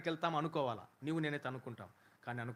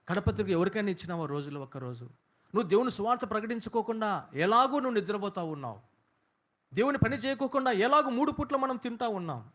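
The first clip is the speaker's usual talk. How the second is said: loud, high fiery exhortation; preacher shouting his challenge to the church; full-force preaching; 8 semitones higher, 8 dB louder than usual. Acted. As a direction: hammer the congregation with rising fervour. Preacher builds to a climax.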